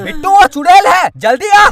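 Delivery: loud, alarmed call; urgent warning shout. Acted. Warning Bittu that the girl is a witch, urgent shout. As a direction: shout a warning to come away.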